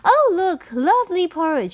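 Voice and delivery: light up at the sight of porridge; delighted girl. Acted. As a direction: Goldilocks thrilled to find porridge. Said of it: "she said".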